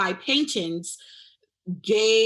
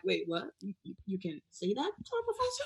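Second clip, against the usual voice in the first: retold shock; 10 dB quieter, faster than usual. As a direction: softly re-enact being stunned by what you heard. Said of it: "I was just so shocked".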